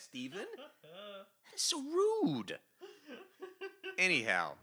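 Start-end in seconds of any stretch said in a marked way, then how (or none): 0.8-2.6 high, drawn-out playful indignation; hammed-up scandalized complaint; mock outrage. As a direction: stretch it out as if deeply offended. Mock outrage at demons denting van.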